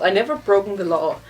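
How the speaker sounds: ordinary delivery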